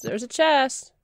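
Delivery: normal talking voice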